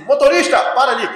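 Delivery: calling out. Retold or imitated. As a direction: shout a stop request across the bus. Bus passenger calls the driver. (passageiro chama o motorista, alto)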